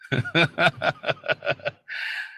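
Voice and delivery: long staccato belly laugh; mocking laugh. Real, not acted. Preacher laughing at challengers too scared to come, mocking laugh. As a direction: laugh at length at the no-shows.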